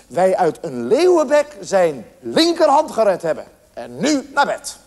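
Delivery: put-on slow, emphatic explanation to a child; stern mother. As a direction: spell it out slowly and finally. Mother lectures son, ends discussion.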